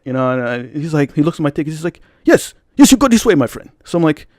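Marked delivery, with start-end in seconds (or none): 2.2-3.7 high-pitched imitation African accent. Tuxedoed usher directs ticket holder, in African accent. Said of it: "some dark-skinned African dude".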